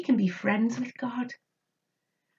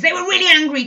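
mock angry; 5 semitones higher, 9 dB louder, much faster than usual. Shown as a demonstration. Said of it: "Can you do an angry face?".

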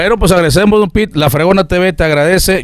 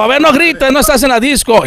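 a hint of a scolding boss, higher; 10 semitones higher than usual. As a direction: tell the noisy young DJ off. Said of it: "Y don Roger dijo".

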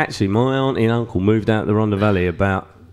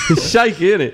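laughing; 5 semitones higher than usual. Real, not acted. Laughing fond remark.

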